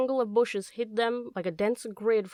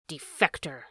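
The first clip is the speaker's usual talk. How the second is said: low, contemptuous spit; spat out; 6 semitones lower than usual. A narrator's reading. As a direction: spit the word out with mild scorn. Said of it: "spat the polecat".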